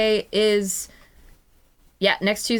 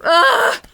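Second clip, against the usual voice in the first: emotional groan; 13 semitones higher, 8 dB louder than usual. Real, not acted.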